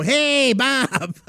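high, drawn-out greeting; Biggerstaff voice. Retold or imitated. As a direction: call out hey Bob in his voice. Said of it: "we would always go".

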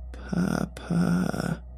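breathy whispered rasp, high and thin; raspy monster voice. Acted. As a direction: rasp it out like a dying creature. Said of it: "in the same raspy voice".

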